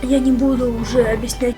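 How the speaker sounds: normal talking voice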